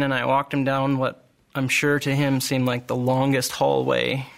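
ordinary delivery

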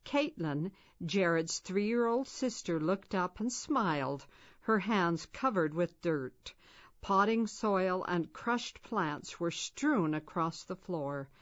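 plain reading voice

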